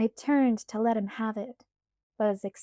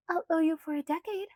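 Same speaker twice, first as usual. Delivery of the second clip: put-on light, higher girlish pleading voice; Alice's voice; 7 semitones higher, faster than usual. A narrator's reading. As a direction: voice Alice higher, coaxing Bella. Alice bargaining.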